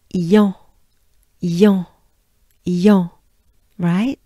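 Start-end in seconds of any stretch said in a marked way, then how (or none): 0.1-3.1 "yon" said louder with a nasal French on; loud demo syllables. Shown as a demonstration. Teacher drilling the syllable loudly for learners. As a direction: project "yon, yon, yon" as a clear drill. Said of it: "So it goes like this".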